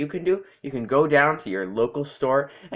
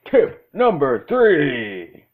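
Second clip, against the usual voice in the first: spooky announcer; 3 semitones higher, 7 dB louder, much slower than usual. Acted. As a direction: drag out the tip title dramatically. Tip title in a spooky voice.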